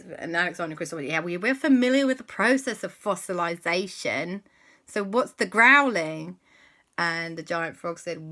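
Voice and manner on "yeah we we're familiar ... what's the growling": put-on impatient kids.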